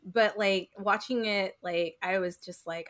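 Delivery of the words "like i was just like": ordinary talk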